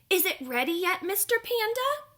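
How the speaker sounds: high, rising egret voice, impatient; eager egret. Acted. Egret asks the panda whether it is ready, high and eager. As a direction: push the question up high and eager.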